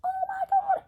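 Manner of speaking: sky-high mock gasp of disbelief; mock shock. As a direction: squeal it in exaggerated, pretend horror.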